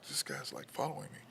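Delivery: hushed, breathy whisper of a nervous thought. Retold or imitated. Young shopper whispering to himself about a stranger following him. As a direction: whisper it to yourself, uneasy.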